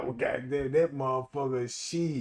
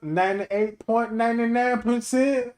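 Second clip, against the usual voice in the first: high, loud laughing delivery; real laughter, loud and slow; laughing punchline; 9 semitones higher, 9 dB louder, slower than usual. Real, not acted. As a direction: crack up through the punchline.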